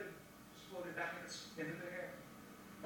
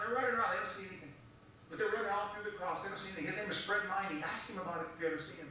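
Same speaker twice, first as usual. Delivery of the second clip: ordinary talk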